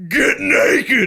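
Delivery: loud, high bossy order; mock command. Friend voicing the wife in the tent, loud command. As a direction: bark it like a drill sergeant. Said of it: "and she goes".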